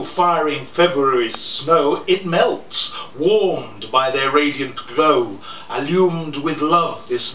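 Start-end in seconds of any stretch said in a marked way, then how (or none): none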